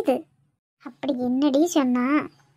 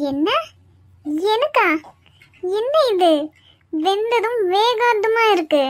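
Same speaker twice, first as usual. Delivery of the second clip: indignant surprise; 4 semitones higher than usual. Acted. Mother-in-law served raw salad.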